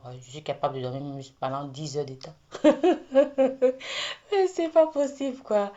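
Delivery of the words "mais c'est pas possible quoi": high disbelieving laugh. Real, not acted. Laughing at her own sleeplessness.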